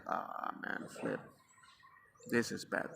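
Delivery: very soft, low murmur; hushed dismay. Retold or imitated. Father to himself on finding son punishing himself, hushed dismay. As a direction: say it under your breath, sinking.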